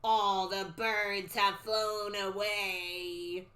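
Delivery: very slow, drawn-out eerie prophecy; stretched, haunting crone voice; old lady soothsayer. Acted. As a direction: intone it like a creepy seer. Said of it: "the old lady talking".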